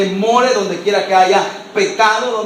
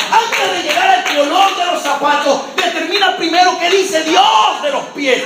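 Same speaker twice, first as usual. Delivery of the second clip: fiery preaching; 5 semitones higher than usual. Real, not acted. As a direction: preach it hard to the church. Preaching builds to shouts. (predicación encendida y a gritos)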